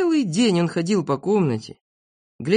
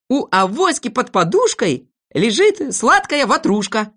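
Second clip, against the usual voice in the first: high-pitched, emphatic couplet; reciting a verse; 7 semitones higher, 4 dB louder than usual. Acted. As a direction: declaim the rhyme with relish. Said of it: "послушайте стихи про Авоську". (декламирует стишок)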